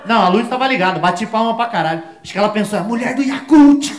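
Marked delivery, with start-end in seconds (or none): 2.8-3.9 mock queen voice. Queen's imagined thought voiced.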